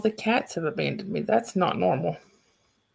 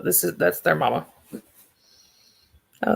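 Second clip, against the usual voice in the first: usual speaking voice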